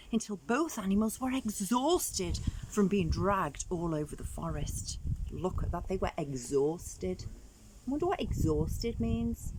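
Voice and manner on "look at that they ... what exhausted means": ordinary delivery, nothing marked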